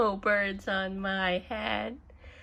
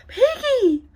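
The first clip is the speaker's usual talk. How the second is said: high, loud cry for help; panicked cry; 11 semitones higher, 5 dB louder than usual. Acted. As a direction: shriek the friend's name in alarm. Gerald panics about the bird.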